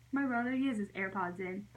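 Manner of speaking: ordinary delivery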